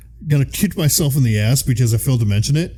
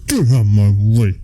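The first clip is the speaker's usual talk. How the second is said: loud, quick mock order; hammed-up pushy demand; mock command; 4 semitones lower, 5 dB louder, faster than usual. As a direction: bellow it playfully, pitched down. Playful mock command, louder.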